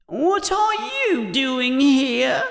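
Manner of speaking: high, slow, drawn-out ghostly whine; plaintive ghost, objecting to intruders. Acted. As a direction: moan it high and slowly. Voices Moaning Myrtle in story.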